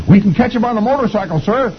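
high cartoon lieutenant voice; eager lieutenant. Lieutenant offers to chase Beetle, high eager voice. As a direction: pipe up helpfully in a squeaky voice. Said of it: "the lieutenant says".

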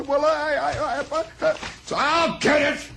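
high stammering boss; flustered boss. Retold or imitated. Mister Moss fumbling after the mix-up, high flustered stammer. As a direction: stumble over it, embarrassed.

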